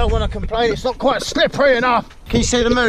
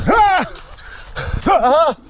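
sharp cry of pain; 6 semitones higher than usual. Real, not acted. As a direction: yelp as if hurt. Sudden pain or slip.

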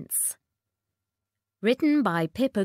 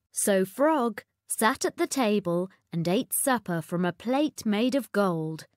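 ordinary delivery

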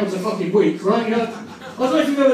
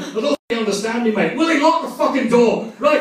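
mock Scotsman, building to a loud order; raised Scottish voice, growing louder; Angus McSporran; 5 dB louder than usual. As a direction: cut in as a huge, menacing Scottish heckler. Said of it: "the biggest Scotsman in the world".

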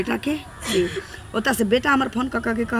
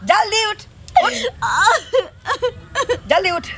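angry shout; 7 semitones higher than usual. Acted. Mother-in-law waking daughter-in-law harshly.

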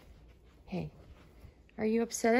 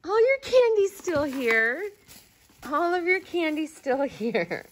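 much higher and slow, bright relief; relieved reveal; 8 semitones higher, 6 dB louder, slower than usual. Real, not acted. A mother reveals the candy prank to her crying daughter, high and slow. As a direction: sing out that the prank is over. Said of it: "I was just kidding".

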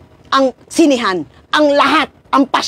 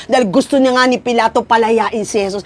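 ordinary talk, nothing marked

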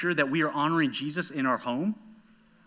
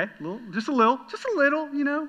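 squeaky minimizing; 11 semitones higher, 3 dB louder than usual. Retold or imitated. Downplaying the spat.

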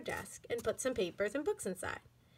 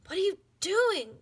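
whispered; 9 semitones higher, 4 dB louder than usual. A narrator's reading. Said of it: "whispered Tiffany".

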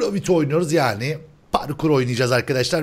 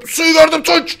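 much higher, loud suyu gördüm; excited shout; 12 semitones higher, 6 dB louder, slower than usual. Real, not acted. Host shouting to his friend that he spotted the water. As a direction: cry out in triumph.

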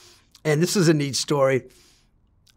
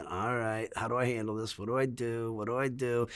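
soft, lower anxious self-talk; quiet worried thought; 4 semitones lower, 12 dB quieter than usual. Retold or imitated. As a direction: think it aloud under your breath. Inner thoughts while surrounded.

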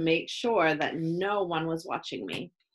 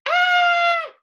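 piercing, loud scream; high scream; 11 dB louder than usual. A narrator's reading. Chloe finding the spider, screaming. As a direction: scream at top pitch in fright. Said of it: "Chloe screamed".

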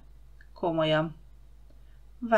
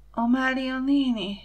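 sleepy whisper; 6 semitones higher than usual. Pretend. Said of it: "suttogta álmosan".

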